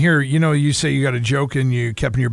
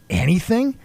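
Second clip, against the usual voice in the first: surprised question; 7 semitones higher than usual. Retold or imitated. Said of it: "he goes".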